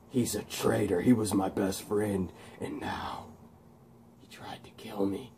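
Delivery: hushed acted betrayal, fading into a whisper; imitating sad Max. Max on his best friend's betrayal, sad fading whisper. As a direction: let it sink away to a whisper. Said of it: "and he gets sad".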